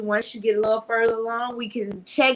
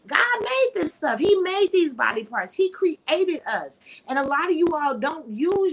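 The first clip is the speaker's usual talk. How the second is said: fervent preaching; 7 semitones higher, 5 dB louder than usual. Real, not acted. Preaching God made the body.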